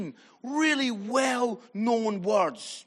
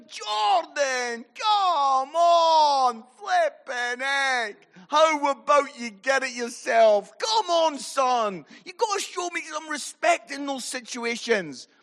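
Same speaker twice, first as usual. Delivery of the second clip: loud, higher, exasperated scolding of a son; miffed telling-off; 5 semitones higher, 5 dB louder, slower than usual. Retold or imitated. Father scolding his son on the way home, loudly exasperated. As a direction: let the annoyance burst out, half comic. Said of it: "so miffed".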